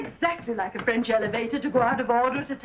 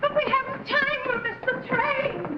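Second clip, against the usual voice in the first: much higher, pleading, the second line slowed right down; frantic urging; 8 semitones higher, slower than usual. Acted. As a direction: beg her to hurry, near panic. Urging Kitty to flee.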